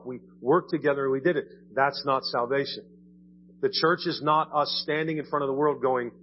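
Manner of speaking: ordinary talk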